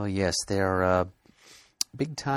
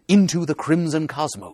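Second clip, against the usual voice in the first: high, loud comic-book title reveal; booming out the story title with relish; dramatic title announcement; 8 semitones higher, 7 dB louder than usual. Acted. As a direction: announce it grandly, pitched up and loud.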